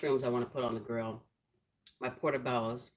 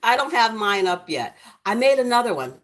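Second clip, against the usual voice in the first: excited; 6 semitones higher, 7 dB louder than usual. Real, not acted. Still excited about gift.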